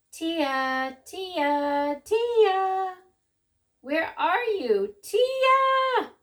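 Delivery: rising, loud sing-song calls; calling out. A narrator's reading. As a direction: call across the store. Father calls for lost daughter.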